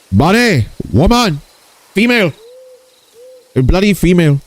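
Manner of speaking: put-on high-pitched caricature Indian accent; comic Indian-accented outburst; mock Indian accent. Returns to the Indian impression voice.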